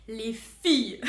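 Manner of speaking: loud, breaking into a laugh. Real, not acted. Telling the girls about the mirror, laughing. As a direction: burst out laughing on the words. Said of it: "Là, je rigole".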